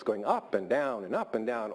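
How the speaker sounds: demonstrative see-saw tune on "up and down"; up-and-down intonation. Shown as a demonstration. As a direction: pitch jumps on each "up" and drops on each "down". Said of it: "just by using your voice".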